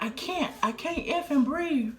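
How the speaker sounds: high, strained can't-breathe cry; desperate, gasping for air; panicked. Retold or imitated. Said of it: "while I'm over here, can't breathe".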